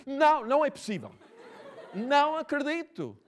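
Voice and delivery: high, loud cry of disbelief with a laugh; shouted disbelief. Retold or imitated. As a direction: shout it like a stunned fan on the metro. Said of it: "E ela gritou". (grito alto de incredulidade, a rir)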